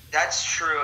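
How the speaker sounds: usual speaking voice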